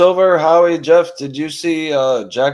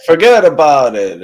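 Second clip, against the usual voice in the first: loud, higher-pitched mock Italian-American; 4 semitones higher, 6 dB louder than usual. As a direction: imitate an Italian-American guy, punching it out. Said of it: "they'd say things like".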